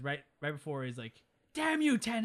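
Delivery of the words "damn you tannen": loud, high-pitched hammed-up curse; mock angry shout. Retelling Doc cursing the villain Tannen, mock angry shout. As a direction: yell it as a mock-dramatic hero.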